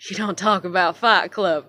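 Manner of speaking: normal talking voice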